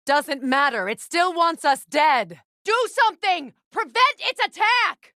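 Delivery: high, loud alarm calls; battle warnings shouted. Acted. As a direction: warn your ally over the noise of the fight. Combat warnings during fight.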